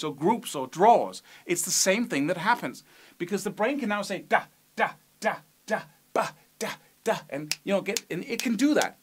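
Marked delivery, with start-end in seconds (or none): 4.2-7.2 slow beats. Shown as a demonstration. Teacher voicing the brain marking each accent, slow beats. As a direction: separate syllables with a gap after each. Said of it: "This is slow enough".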